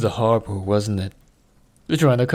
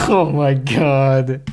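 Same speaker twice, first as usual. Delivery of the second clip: laughing; 4 semitones higher, 6 dB louder, much slower than usual. Real, not acted. Streamer laughing at his own mistake.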